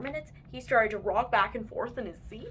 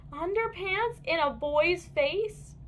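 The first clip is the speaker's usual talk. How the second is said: slow, higher, disbelieving; outraged boy; 7 semitones higher, slower than usual. A narrator's reading. Boy protests to his mom about underpants, slow and outraged. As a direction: drag it out in disbelief. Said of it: "yelled Taylor J".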